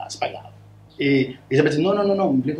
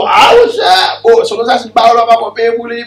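raised, higher, louder retold protest; mock outburst; 8 semitones higher, 8 dB louder than usual. The producer refuses to pay for the solo, in a louder, higher mock outburst.

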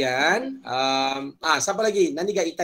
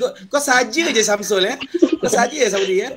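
loud teasing; 5 semitones higher, 6 dB louder than usual. Real, not acted. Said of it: "teasing student".

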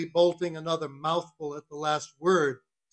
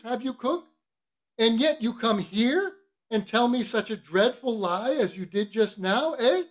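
higher mock-pious cross-examination; reproving mate; 6 semitones higher, 4 dB louder than usual. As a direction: build to a pointed questioning end. Stubb voiced, reproving the cook.

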